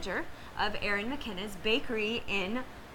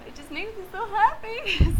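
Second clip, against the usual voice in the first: laughing happily; 11 semitones higher, 5 dB louder than usual. Real, not acted. Gushing about allergy-free bakery.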